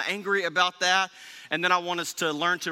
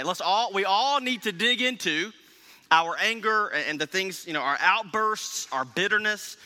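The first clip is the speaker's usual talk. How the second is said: normal talking voice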